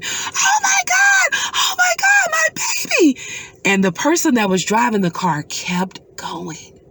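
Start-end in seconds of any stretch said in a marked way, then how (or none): 0.0-3.2 screaming and crying. Retold or imitated. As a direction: wail over the lost child. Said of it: "and screaming".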